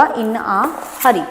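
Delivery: very slow, stretched dictation. Shown as a demonstration. Teacher dictating the idiom very slowly. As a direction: draw out In a hurry for them to write. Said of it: "ਆਹ ਲਿਖ ਲਓ".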